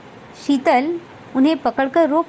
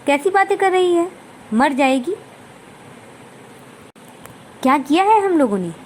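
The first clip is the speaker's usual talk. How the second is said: thundering anger; 4 semitones higher than usual. Acted. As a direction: boom out the question, offended and hurried. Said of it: "सासू माँ की आवाज़ में गरज थी".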